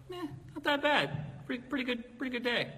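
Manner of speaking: high, airy shrug of a reply; breezy mock cheer. Preacher quoting his own breezy answer to the barista, pitched high. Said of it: "And I was like".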